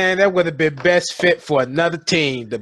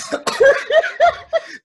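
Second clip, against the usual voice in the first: high-pitched cackle; high laugh. Real, not acted. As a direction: squeal with laughter. Laughs at team names.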